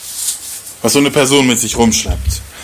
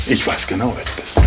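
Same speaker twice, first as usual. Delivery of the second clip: soft reassurance; 4 semitones lower, 6 dB quieter than usual. Acted. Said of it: "dann kommt ein Typ und sagt".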